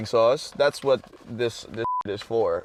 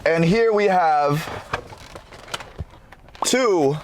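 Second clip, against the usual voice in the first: presenter voice; 4 semitones higher, slower than usual. Acted. Slow presenter-style food showcase.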